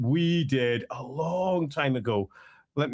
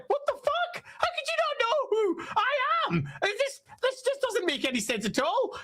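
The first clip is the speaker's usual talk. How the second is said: very high, squealing mock outrage; hammed-up villain meltdown in a strained high voice; Thanquol freaking out. Villain whose captives don't know who he is, freaking out. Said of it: "And he freaks out".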